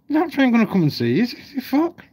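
mock incredulous squeal of a rhetorical question; scoffing disbelief. Real, not acted. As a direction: pitch it way up in scornful disbelief. Mocking incredulous rant.

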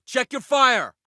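high, urgent protest under fire; sharp battlefield reprimand; alarmed shout. Acted. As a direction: shout at a teammate to stop.

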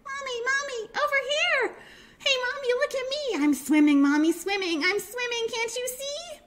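put-on very high-pitched baby penguin, thrilled; little excited child voice. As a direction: squeak with delight as a tiny penguin. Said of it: "came a little voice she knew".

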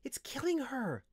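high-pitched, urgent character voice; urgent hissed protest. A narrator's reading. Windling warning the wizard, urgent and high. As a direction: protest tensely in a high little voice. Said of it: "Linthus hissed".

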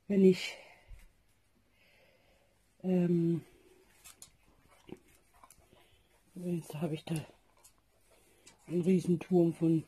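drowsy, soft early morning voice; tired and barely awake; sleepy voice. Real, not acted. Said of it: "entschuldigt die schläfrige Stimme". (verschlafene Stimme)

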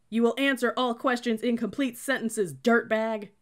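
loud, high scolding; barked reprimand. A narrator's reading. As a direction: tear into the recruit. Cadet berating the narrator.

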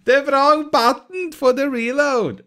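loud, high, drawn-out complaint; exasperated exclamation. Real, not acted. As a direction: wail at your own blunder. Exclaims over own blunder.

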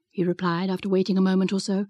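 ordinary delivery

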